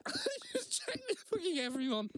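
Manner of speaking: very high voice breaking into laughter; laughing confession. Real, not acted. Admitting he checked with everyone there, laughing. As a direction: get the words out through high laughter. Laughing at his own compulsion.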